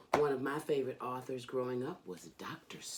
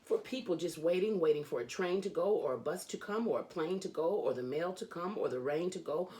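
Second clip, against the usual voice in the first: lively, sing-song storybook reading; reading to children; 3 semitones higher than usual. A narrator's reading. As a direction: read it like a bedtime book for kids. Reads Dr. Seuss to children.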